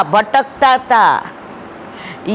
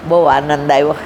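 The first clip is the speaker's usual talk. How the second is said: contented devotee; 11 semitones lower than usual. Retold or imitated. Preacher quoting a simple Vaishnav after a humble farm meal, voiced much lower and content. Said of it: "તોય વૈષ્ણવ શું કીધું ખબર?".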